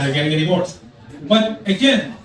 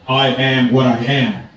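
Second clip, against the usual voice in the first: low, slow divine pronouncement; voice of God; 5 semitones lower, slower than usual. Acted. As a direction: intone it slowly and low, as God. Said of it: "And God did not want to offend".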